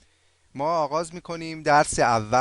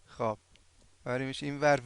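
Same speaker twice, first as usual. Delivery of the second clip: ordinary talk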